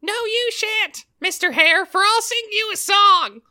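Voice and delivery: a tiny bun squeaking back; squeaky bun voice. Acted. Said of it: "Not in that voice".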